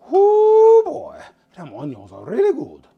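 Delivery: hammed-up Cajun cook, starting on a high loud whoop; terrible Cajun accent. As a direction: do a bad Cajun impression, big and loud. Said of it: "That's a terrible Cajun accent".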